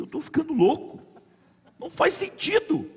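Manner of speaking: high-pitched incredulous protest; acted-out bewildered objection; retold disbelief. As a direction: question your own sanity, voice climbing high. Retells his disbelief at offering money.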